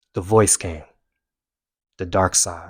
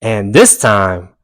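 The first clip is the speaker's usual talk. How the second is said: usual speaking voice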